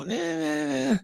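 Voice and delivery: a high mock "Eh." of doubt from people; skeptical eh. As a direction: play the listeners going "Eh." as if they can't believe it. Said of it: "People are gonna go".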